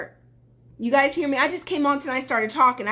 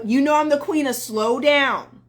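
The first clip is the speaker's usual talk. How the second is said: usual speaking voice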